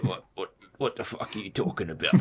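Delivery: mock Cockney cop, laughing through it; rough London street voice, half-joking; Cockney accent. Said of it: "like, Cockney accent".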